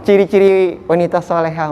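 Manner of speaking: ordinary talk, nothing marked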